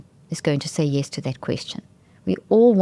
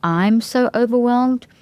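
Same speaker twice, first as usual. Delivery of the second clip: higher, slow anxious whine; mock panicky complaint; mock overwhelmed; 6 semitones higher, 3 dB louder, slower than usual. Shown as a demonstration. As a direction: act out being overwhelmed. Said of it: "So instead of".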